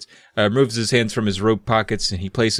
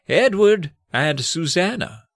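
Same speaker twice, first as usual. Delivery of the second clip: put-on slow, higher old man voice; 7 semitones higher, slower than usual. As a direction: call the names out slowly as a gentle elder. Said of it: "And the old man calls them".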